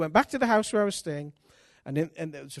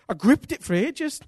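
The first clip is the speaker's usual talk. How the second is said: louder emphatic restatement; emphatic repeat; 7 semitones higher, 5 dB louder than usual. Shown as a demonstration. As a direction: punch it the second time, louder.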